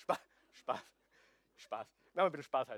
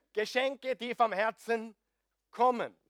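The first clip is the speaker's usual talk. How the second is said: louder, higher, smug and self-satisfied; mock arrogant; 5 semitones higher, 6 dB louder than usual. Preacher mocking himself to the congregation, smug. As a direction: preen about yourself as the gift. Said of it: "Das war jetzt sehr arrogant".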